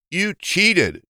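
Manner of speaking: high, indignant accusation; accusing. A narrator's reading. Hare accuses the hedgehogs of cheating. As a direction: throw the charge back at them.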